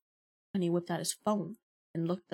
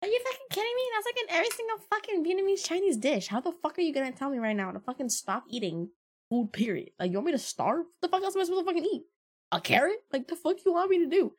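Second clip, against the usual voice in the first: much higher, indignant retold rant; replaying how she snapped at her boyfriend, high and outraged; retold outrage; 10 semitones higher, 4 dB louder than usual. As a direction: fire it back at him in disbelief. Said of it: "And I'm like".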